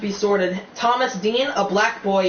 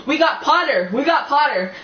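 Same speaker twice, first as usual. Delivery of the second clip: yelling twins; 6 semitones higher than usual. A narrator's reading. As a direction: shout it out in glee. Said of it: "twins yelled".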